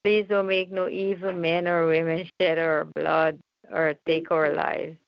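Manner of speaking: usual speaking voice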